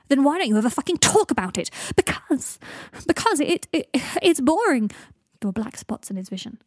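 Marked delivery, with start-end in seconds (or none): 0.1-1.7 loud, higher-pitched outburst; angry demand. A narrator's reading. Greg demands why Draco never talks, angry and loud. 1.9-4.9 loud, high, stumbling comeback; stammer, then burst out with it; agitated retort. A narrator's reading. Draco snaps under Greg's accusation, loud and faltering.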